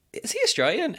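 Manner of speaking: high, doubtful "Is he Australian?"; puzzled question. Retold or imitated. Watching Mortal Kombat and doubting the actor's accent, high and puzzled. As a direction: sound honestly unsure. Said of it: "I was just like".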